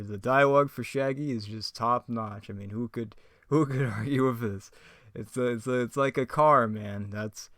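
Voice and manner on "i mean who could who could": ordinary talk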